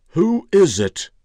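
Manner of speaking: loud, high, drawn-out demand; demanding old man. A narrator's reading. Old man calling through the door, demanding. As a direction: call out sharply and slowly, high and loud. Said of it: "the old man's voice demanded".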